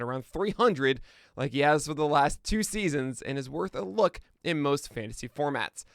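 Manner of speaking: energetic, projected sports-update host voice; lively announcer-style rundown; podcast news read. Real, not acted. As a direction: punch through the headlines like a morning sports host.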